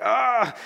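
loud, high "aah" of alarm; mock panicked yell. Preacher acting out getting an all-caps email, mock panic. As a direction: cry out in comic dread. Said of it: "you know you're in trouble".

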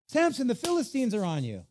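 high, loud mock alarm; Delilah. Delilah waking Samson in the loom, loud mock alarm. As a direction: cry out a put-on warning to rouse a sleeper.

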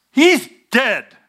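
high, loud retold rage; furious threat. Young brother telling his mother, high furious threat. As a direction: spit it out like a furious teen. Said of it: "Told my mother".

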